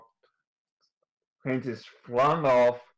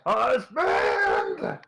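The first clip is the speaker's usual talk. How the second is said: shrill desperate scream for help; screaming for husband; 10 semitones lower, 6 dB louder than usual. Acted. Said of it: "She just screams".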